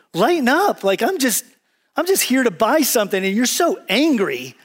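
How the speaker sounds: high, swooping mock protest; exasperated. Preacher acts out customer facing angry person, exasperated. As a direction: plead with the grouch to ease up.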